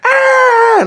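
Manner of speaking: high, drawn-out "aaah" wail; imitated child's wail. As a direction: mimic a toddler wailing at the top. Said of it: "nó la điếng lên nè".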